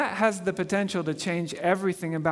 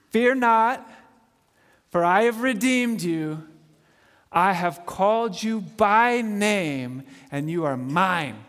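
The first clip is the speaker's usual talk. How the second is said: scripture reading; slower than usual. Real, not acted. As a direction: proclaim God's words with weight. Reads scripture aloud.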